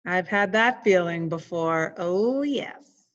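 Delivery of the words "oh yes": usual speaking voice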